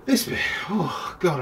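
breathless, puffing; out of breath. Real, not acted. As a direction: gasp it out winded. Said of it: "I'm out of breath".

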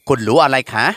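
usual speaking voice

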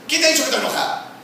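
high, rapid mock-indignant denial; angry cat-like retort. Spouse denying being angry, high mock-angry retort. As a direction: snap back fast and high, like a riled cat. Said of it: "Suena como el gato".

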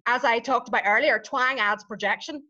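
normal talking voice

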